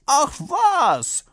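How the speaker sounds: high, loud brush-off; scornful rider. A narrator's reading. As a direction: reject it with a loud scoff. Rider rejects the bottle. (laut und hoch, verächtlich abwehrend)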